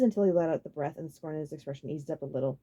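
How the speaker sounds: ordinary delivery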